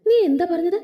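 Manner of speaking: can't believe what she just heard; stunned disbelief. A narrator's reading. Anu reacting to news of the wedding, stunned. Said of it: "കേട്ടത് വിശ്വസിക്കാനാവാതെ".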